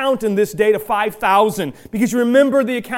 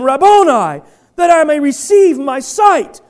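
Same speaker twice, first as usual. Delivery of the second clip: pleading cry; 5 semitones higher, 4 dB louder than usual. Acted. Blind man begging Jesus.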